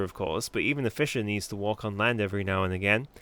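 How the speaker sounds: ordinary delivery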